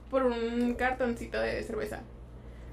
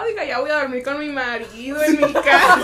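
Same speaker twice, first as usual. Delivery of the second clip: telling the clerk's excuse louder through laughter; loud laughing self quote; 11 dB louder than usual. Retold or imitated. Retelling her excuse to a customer, laughing loudly. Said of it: "le dije".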